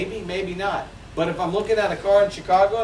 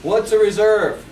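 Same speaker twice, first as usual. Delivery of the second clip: impatient customer; 5 semitones higher, 6 dB louder, slower than usual. Retold or imitated. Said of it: "where they say".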